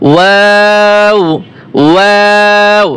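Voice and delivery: ওয়াও held long, opening to 'waa' then rounding to 'w'; slow, sustained ওয়াও; drawn-out waw. Shown as a demonstration. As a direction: stretch ওয়াও from relaxed mouth to rounded lips. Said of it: "টান আসে তখন মুখ স্বাভাবিক".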